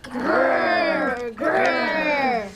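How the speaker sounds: loud, drawn-out group growl; bear growl. Pretend. Pupils reading the bear's part together, loud growl. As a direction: growl loudly like little bears.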